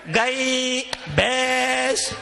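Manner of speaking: very slow, each word stretched; drawn-out punchline. Real, not acted. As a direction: drag out the two words for comic effect. (बहुत खींचकर बोलना)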